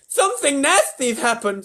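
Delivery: high, loud, slow pronouncement; alarmed president. A narrator's reading. President reacts to silence.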